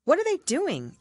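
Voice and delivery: ordinary delivery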